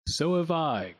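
mild groan. A narrator's reading. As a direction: groan it in agreement. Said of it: "groaned Grim".

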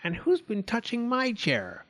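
high, annoyed mother voice; annoyed Mummy Bear. A narrator's reading. Mummy Bear finds her chair touched, annoyed. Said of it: "said in an annoyed voice".

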